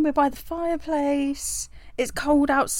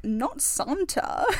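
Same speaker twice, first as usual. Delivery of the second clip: soft drawn-out imitation; 10 dB quieter, much slower than usual. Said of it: "She was like".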